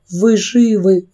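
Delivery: выше, с выдохом облегчения; выдохни это; на выдохе. A narrator's reading. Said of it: "выдохнула Кэрри". (на выдохе с облегчением)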